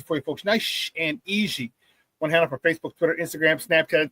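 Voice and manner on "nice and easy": "Nice and easy" stretched out very slowly; drawn-out, laid-back "Nice and easy". Shown as a demonstration. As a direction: let each word of "Nice and easy" linger, unhurried. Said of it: "I made it nice and easy".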